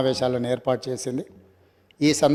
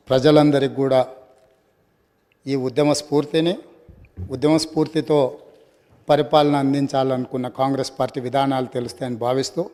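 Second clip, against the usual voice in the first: normal talking voice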